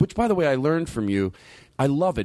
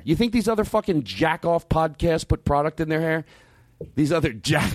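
ordinary talk

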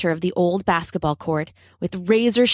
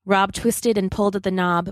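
usual speaking voice

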